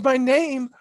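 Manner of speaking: high, loud mock-flustered princess; flustered Aurora. Aurora refusing to tell the prince her name, mock flustered. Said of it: "She goes".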